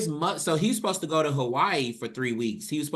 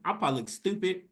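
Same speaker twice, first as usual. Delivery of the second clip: normal talking voice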